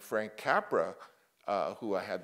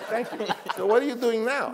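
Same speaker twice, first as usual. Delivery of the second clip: put-on much higher and louder, an old mogul gushing; Sam Goldwyn impression; 13 semitones higher, 8 dB louder than usual. Old Goldwyn praising young Coppola, high loud imitation. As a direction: play the studio boss fawning over a young talent. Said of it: "he talked like I'm gonna talk".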